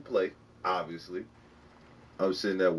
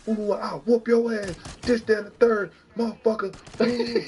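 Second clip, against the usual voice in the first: towel threat; 6 semitones higher than usual. Retold or imitated. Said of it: "This man in his towel".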